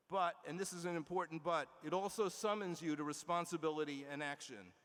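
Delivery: usual speaking voice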